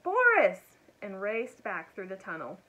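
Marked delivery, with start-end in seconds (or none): 0.0-0.8 high, loud, drawn-out shout; shouted call. A narrator's reading. Elmer signals Boris to charge, shouting. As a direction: holler the name across a distance. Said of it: "Elmer shouted".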